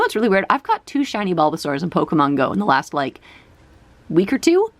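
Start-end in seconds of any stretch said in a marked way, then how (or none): none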